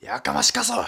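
high, loud Nagasaki-dialect snap of 「やかましかそ！」; Nagasaki dialect retort. Retold or imitated. Comedian showing his failed Nagasaki-dialect tsukkomi, high and loud. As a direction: bark it in thick Nagasaki dialect. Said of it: "急に長崎弁使って大失敗したな".

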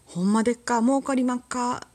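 imitation fake Kansai speech; fake Kansai dialect. Shown as a demonstration. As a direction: put on a hammy Kansai merchant phrase. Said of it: "エセ関西弁八".

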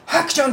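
high loud acted sneeze; sneezing. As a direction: fake a big sneeze up high. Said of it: "ってなった瞬間".

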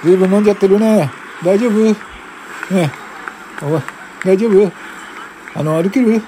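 brisk loud policeman; high, hurried policeman; fast, loud officer. Acted. Officer talking to drunk woman.

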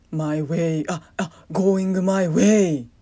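loud, belted catchphrase; loud proud declaration. Pretend. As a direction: proclaim it proudly. Belting a catchphrase.

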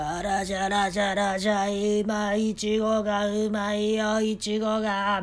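put-on old market hawker, sing-song and drawn out; slow, sing-song market hawker voice; market vendor call. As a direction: call out to passers-by like an old stallkeeper.

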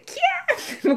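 very high delighted squeal; children's squealing kyaa; kids' squeal. Retold or imitated. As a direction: shriek like excited children. Kids squealing greeting.

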